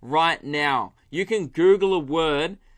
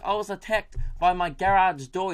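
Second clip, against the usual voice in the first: higher-pitched imitation of a Kiwi caller; New Zealand accent; 4 semitones higher than usual. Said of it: "I've got it now".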